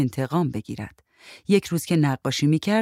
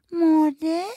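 put-on high little-kid voice, rising question; small child asking; 13 semitones higher, much slower than usual. A narrator's reading. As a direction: ask like an unsure little child. Child asks the woman about the fish. (صدای نازک و پرسشگر بچه)

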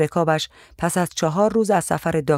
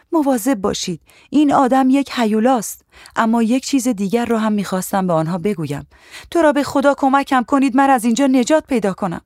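higher, urgent pleading; a trapped girl's pleading cry; desperate plea; 6 semitones higher, 3 dB louder than usual. Acted. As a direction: beg them for help in a frightened rush. Silent plea she wished to make.

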